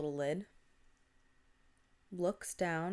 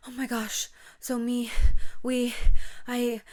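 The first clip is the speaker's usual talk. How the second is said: acted panic, higher-pitched, broken fragments; freaking out; 5 semitones higher, 10 dB louder than usual. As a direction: stammer out words like a panicked friend. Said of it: "she's freaking out".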